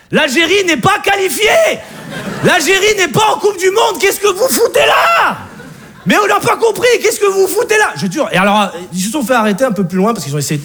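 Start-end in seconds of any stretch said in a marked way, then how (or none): 0.1-7.9 high-pitched, exasperated comic shout; incredulous shouting. Acted. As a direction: yell it in mock outrage. Acted outcry at Algerian supporters.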